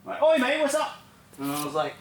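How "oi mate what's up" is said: put-on high-pitched British accent; British lad. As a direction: put on a British lad's greeting. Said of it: "back to the British boys".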